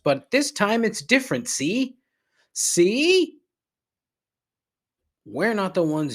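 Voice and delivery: high, loud mocking singsong; sarcastic put-on voice mocking American exceptionalism. Sarcastic mock voice.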